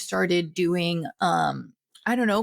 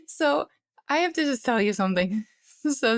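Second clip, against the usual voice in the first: laughing; 4 semitones higher than usual. Real, not acted. Laughing at own breathlessness.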